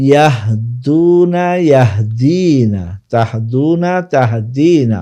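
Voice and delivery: ইয়াহদুনা with long DUU, ইয়াহদিনা with long DII; pronunciation demonstration. Shown as a demonstration. Teacher contrasting masculine and feminine forms, long vowels in ইয়াহদুনা, ইয়াহদিনা. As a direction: stretch the middle vowels of ইয়াহদুনা, ইয়াহদিনা, তাহদুনা, তাহদিনা. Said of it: "মুয়ান্নাসের ক্ষেত্রে উচ্চারণটা".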